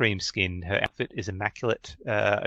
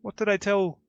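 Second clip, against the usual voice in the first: halfling lady voice; 11 semitones higher than usual. Acted. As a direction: coo over him like a pampered noblewoman. Edna puzzled.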